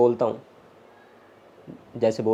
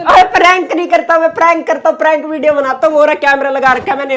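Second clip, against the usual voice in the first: much higher, insistent pleading voice; urgent reassurance; 8 semitones higher, 3 dB louder than usual. Real, not acted. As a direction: explain urgently to calm an upset sister. Insisting it was only a prank.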